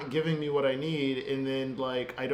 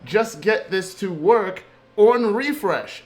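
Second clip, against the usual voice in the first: loud, higher, slowed frustrated plea to the code; half-yelling at the screen in disbelief; exasperated outburst; 5 semitones higher, 7 dB louder than usual. Retold or imitated. Coder venting at a broken feature, loud and exasperated. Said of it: "I'm just like".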